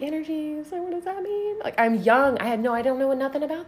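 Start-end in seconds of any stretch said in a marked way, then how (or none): 0.0-1.6 high, soft baffled question; high-voiced retold bewilderment; mock confusion. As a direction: pitch it up, utterly lost. Retold confusion at neighbor's claim.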